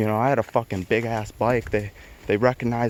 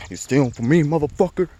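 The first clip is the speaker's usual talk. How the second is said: furious threat; 7 semitones higher than usual. Retold or imitated. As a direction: snarl it like a livid thug choking a thief. Livid car owner choking him.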